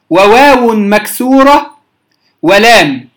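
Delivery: ordinary talk, nothing marked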